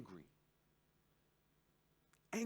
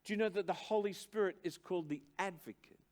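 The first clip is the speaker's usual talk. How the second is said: loud, raised, insistent sermon delivery; preaching at full force; 6 semitones higher, 5 dB louder than usual. Acted. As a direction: drive the definition home from the pulpit. Preacher exhorting, slowing for emphasis.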